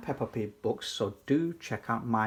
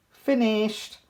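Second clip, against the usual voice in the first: a touch of a cheery cheer, pitched up; mild squirrel shout; 7 semitones higher, 3 dB louder than usual. Acted. Squirrels finish the pie, mild happy shout. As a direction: call it out lightly as the squirrels. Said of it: "shout the squirrels".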